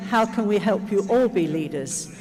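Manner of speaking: usual speaking voice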